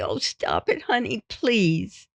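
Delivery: slow, soft, high plea; scared wife, imitated. Imitated wife begging her husband to stop, scared soft plea. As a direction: plead quietly, drawing out the last word. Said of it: "she was always scared".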